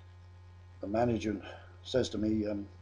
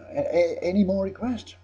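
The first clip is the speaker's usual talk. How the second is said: high, loud call for more; 10 semitones higher, 9 dB louder than usual. Retold or imitated. Singer asks pub for more requests, calling out. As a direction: throw it out loudly to the whole crowd.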